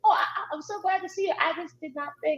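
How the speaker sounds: high, fast, flustered over-apology; flustered gushing. Retold or imitated. Retelling flustered woman's gushing apology.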